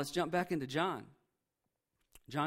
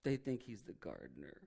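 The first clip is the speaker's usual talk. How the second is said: much lower, much softer aside; mock whisper; 9 semitones lower, 9 dB quieter than usual. Angels peeking from the tomb, muttering in quiet disbelief. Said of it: "angels looking out of the tomb going".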